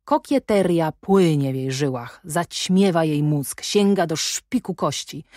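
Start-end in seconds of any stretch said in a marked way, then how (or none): none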